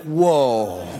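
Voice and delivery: low, drawn-out whoa of wonder; like sound just switched back on; amazed at suddenly hearing again; awed whoa. Retold or imitated. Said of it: "and you're like".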